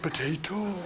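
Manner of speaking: "Potato" sehr leise, walisisch mit steigendem Ende; "Potato" gehaucht und fragend walisisch; Welsh "Potato". Shown as a demonstration. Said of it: "you've got that subtle".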